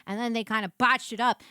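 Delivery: ordinary talk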